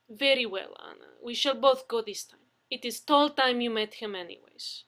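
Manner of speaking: put-on dreamily in a Russian accent; Russian woman explaining to Brewer, in a Russian accent. Russian woman character held.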